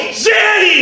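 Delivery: retold yelled correction; shouting back. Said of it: "They go".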